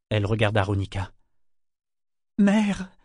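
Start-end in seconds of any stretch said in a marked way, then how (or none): 2.4-2.9 high, short plea; horrified appeal. A narrator's reading. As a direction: appeal to a mother in horror. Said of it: "fit-elle à travers ses doigts". (appel horrifié)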